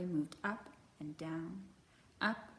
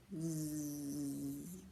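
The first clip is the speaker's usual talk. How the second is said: low buzzing snore; drowsy bear snore; snoring zzz; 6 semitones lower, 5 dB quieter than usual. Acted. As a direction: hum a sleepy zzz through the nose.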